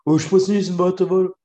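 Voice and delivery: mocking high imitation; imitating friend. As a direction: parrot him in a whiny voice. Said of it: "Einfach so".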